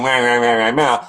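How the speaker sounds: mimicked childish meh-meh fussing; whining dramatic child. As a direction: imitate a kid's dramatic grumbling. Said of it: "if she's just being".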